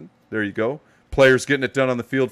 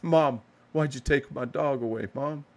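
mock sad kid; 4 semitones higher, 5 dB quieter than usual. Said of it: "he looks kinda sad there".

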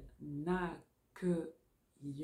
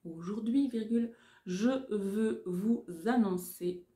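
dictating; 3 semitones higher, 4 dB louder than usual. Shown as a demonstration. Dictation with punctuation spoken.

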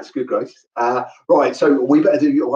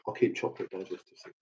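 quiet aside; 4 semitones lower, 16 dB quieter, faster than usual. Real, not acted. Muttered aside about biscuits.

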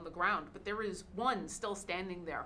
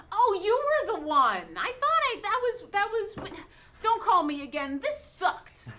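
high, loud, exasperated gnome rant; high squeaky gnome complaining; cranky gnome cleric; 8 semitones higher, 6 dB louder than usual. Acted. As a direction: whine it like a fed-up little cleric. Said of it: "the small little gnome says".

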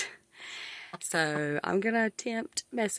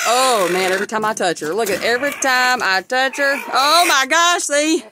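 louder and higher, rising alarm; 4 semitones higher, 6 dB louder than usual. Real, not acted. Hen keeps pecking, rising loud alarm. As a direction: get louder as she pecks. Hen pecks at her hand.

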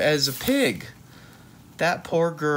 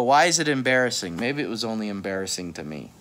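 ordinary delivery

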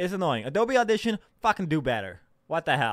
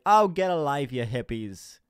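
offhand jab, starting loud then dropping; casual jeer; 4 dB louder, slower than usual. Pretend. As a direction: toss it off dismissively, no real yell. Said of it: "Whereas if I just like".

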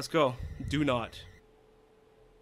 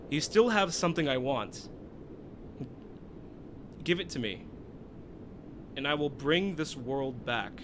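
villain voice; 5 semitones lower, 8 dB quieter than usual. Acted. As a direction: intone it like a cold dark child. Reading villain text aloud.

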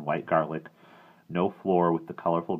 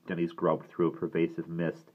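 plain reading voice

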